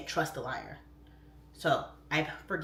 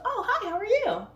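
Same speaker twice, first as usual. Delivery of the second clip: high, loud sing-song hello; welcoming a new follower, pitched way up; bubbly greeting; 7 dB louder than usual. Retold or imitated. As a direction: greet them all sparkly.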